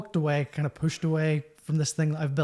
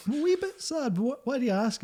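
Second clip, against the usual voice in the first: high, mild mock-meek voice; high retold reply; 9 semitones higher than usual. Musician answering comedian's question, high retold reply. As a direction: pitch it up, imitating your own past reply.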